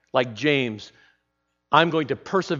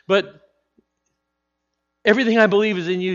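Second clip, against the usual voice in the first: higher, rushed, laughing confession; overwhelmed Martha; 8 semitones higher, 6 dB louder than usual. Acted. Martha blurting her faith to Jesus, high and rushed with a laugh. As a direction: gush it out, half laughing.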